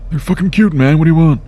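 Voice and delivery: high, squeaky defensive retort; mock defensive. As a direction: snap back playfully.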